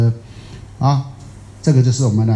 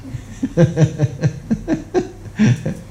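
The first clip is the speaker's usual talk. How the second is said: breathy laugh; 4 semitones higher, 4 dB quieter than usual. Real, not acted. Laughing with audience.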